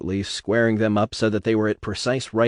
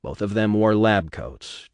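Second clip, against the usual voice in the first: usual speaking voice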